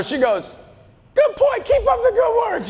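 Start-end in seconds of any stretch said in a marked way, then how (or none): 1.1-2.7 put-on high-pitched old-lady voice; imitated elderly lady, pitched way up; nice old lady. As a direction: chirp back like an old lady. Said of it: "this nice lady comes down".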